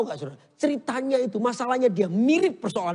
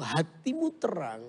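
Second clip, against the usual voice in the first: soft preaching; 7 semitones lower, 5 dB quieter than usual. Acted. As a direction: lower and softer, slow, intimate sermon. (khotbah lembut dan pelan)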